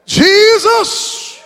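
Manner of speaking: high, shouted preacher's cry; preaching at full force. Acted. Preacher proclaiming the psalm to the church. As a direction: ring out Jesus then slow down.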